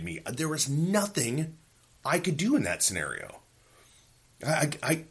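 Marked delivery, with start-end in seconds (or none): none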